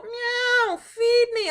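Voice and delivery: high, loud whiny cat begging. Retold or imitated. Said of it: "starts pawing at me and meowing".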